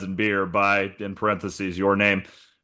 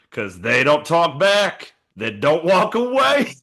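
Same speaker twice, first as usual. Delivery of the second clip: mock country twang, pitched higher; 7 semitones higher, slower than usual. Starting the chorus of the joke song, in a hammed-up country accent. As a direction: croon the chorus in a Southern accent. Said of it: "my best country voice".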